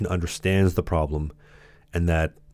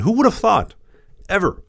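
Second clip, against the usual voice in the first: much higher, sarcastic mock astonishment; sarcastic disbelief; 12 semitones higher, 4 dB louder than usual. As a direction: squeal it up in fake wonder. Sarcastic rhetorical question.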